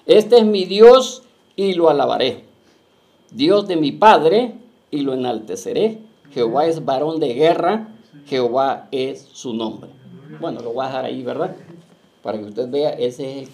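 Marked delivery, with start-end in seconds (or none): 0.0-9.9 loud, slow, declaiming preacher reading the verse; scripture reading. Acted. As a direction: proclaim each line to the congregation. Preacher reads scripture aloud. (proclamación lenta y fuerte del versículo)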